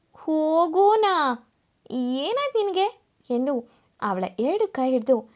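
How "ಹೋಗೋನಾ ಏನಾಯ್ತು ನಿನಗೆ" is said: higher, drawn-out incredulous question; wide-eyed disbelief. A narrator's reading. Husband stunned by wife telling him to go, incredulous. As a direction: stretch the first word in loud astonishment. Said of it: "ಆದಿ ಕಣ್ಣು ಅಗಲ್ಸಿ".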